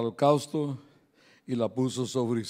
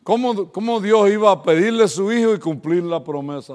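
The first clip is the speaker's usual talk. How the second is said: loud, higher preaching voice; 6 semitones higher, 11 dB louder than usual. Acted. Pastor to the congregation retelling Isaac's question, preaching loudly. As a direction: proclaim the question to the crowd.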